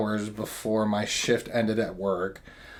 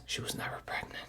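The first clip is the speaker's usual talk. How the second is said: unvoiced stage whisper aside; 19 dB quieter, faster than usual. Real, not acted. Telling the audience a secret, stage whisper. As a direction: lean in and whisper the punchline. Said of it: "whispered aside".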